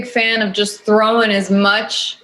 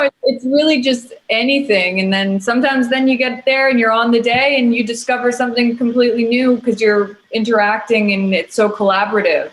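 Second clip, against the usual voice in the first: ordinary talk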